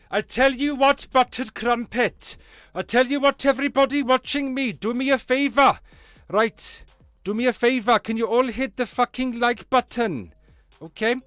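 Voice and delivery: put-on loud, high-pitched Welsh accent; big Welsh voice à la Lee Evans. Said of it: "you want a Welsh accent".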